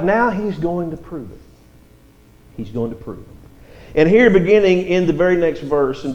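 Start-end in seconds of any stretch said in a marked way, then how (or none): 2.6-3.2 softer, lower repeat of the line; quiet emphatic echo of the point. Shown as a demonstration. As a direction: let the repeat drop to a near hush. Preacher repeats a key line.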